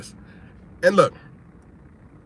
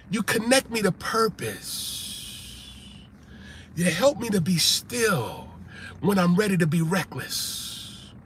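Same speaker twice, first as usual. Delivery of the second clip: tender sincere; 7 dB quieter, slower than usual. Real, not acted.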